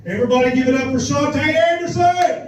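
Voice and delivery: high, loud hype introduction; announcer intro. Real, not acted. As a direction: bring her up like a host. Introducing next comic.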